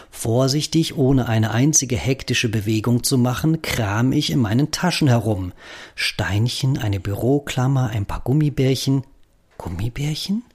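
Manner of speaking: ordinary delivery, nothing marked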